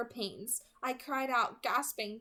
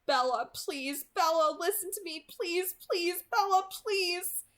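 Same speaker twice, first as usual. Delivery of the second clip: high, loud, slow desperate pleading; begging; 7 semitones higher, 4 dB louder than usual. A narrator's reading. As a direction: beg her to listen, voice rising. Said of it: "he begged".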